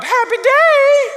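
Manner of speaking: squealed Southern "Happy day!", very high and loud; Southern accent. Retold or imitated. Girl greeting staff at dawn, high Southern squeal. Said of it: "I don't wake up".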